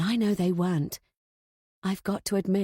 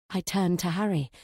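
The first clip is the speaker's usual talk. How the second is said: usual speaking voice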